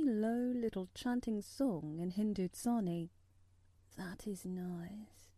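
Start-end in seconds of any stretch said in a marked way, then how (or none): 4.0-5.3 slow, sleepy whisper; a slow whisper, drifting off to sleep; drowsy whisper. A narrator's reading. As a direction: breathe it out half asleep. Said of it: "he said more drowsily still".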